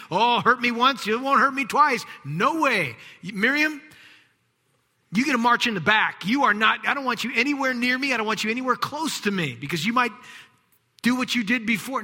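high, loud defiant refusal; mock-bitter brother refusing forgiveness; resentful rant. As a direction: shove her away angrily. Imagined resentful banishment of Miriam.

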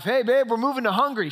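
re-enacted eager call to a spouse; acted eager announcement. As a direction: announce big news to your wife. Acting his announcement to his wife.

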